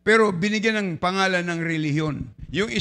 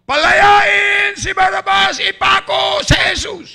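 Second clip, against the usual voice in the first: much higher, loud, slow mob chant; crowd shouting; 12 semitones higher, 9 dB louder, slower than usual. Acted. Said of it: "Anong sabi ng mga tao?".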